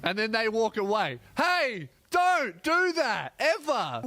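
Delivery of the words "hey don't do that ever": high, loud, drawn-out scolding; angry outburst. Acted. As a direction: tell off an intrusive waiter, each word hit hard. Acted outburst at interrupting waiters.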